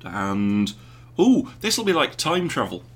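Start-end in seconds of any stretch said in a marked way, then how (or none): 1.2-2.8 higher, louder, delighted ooh; thrilled by the idea; giddy time travel excitement; excited. Real, not acted. Excited idea while wife leaves.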